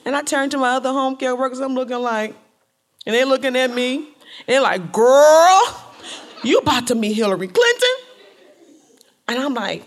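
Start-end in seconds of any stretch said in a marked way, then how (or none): 4.9-7.9 thrilled, incredulous and loud; excited friend. Retold or imitated. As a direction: squeal it like an amazed girlfriend. Friends react to big news.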